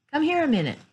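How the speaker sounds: high-pitched call down the hallway; high holler to someone out of sight. A narrator's reading. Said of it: "Then he called out".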